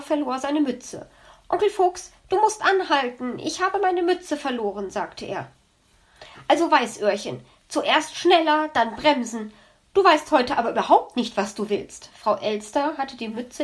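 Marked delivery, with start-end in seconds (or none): 1.5-4.9 lightly voiced upset child. Child lost cap on sled. 6.5-12.1 lightly voiced exasperated uncle. Fox scolds nephew.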